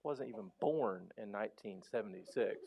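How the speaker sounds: answering the nitpicking sleuth, quiet and deadpan; deadpan comeback. Retold or imitated. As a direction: mutter it low and flat-out dry. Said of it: "And you're like".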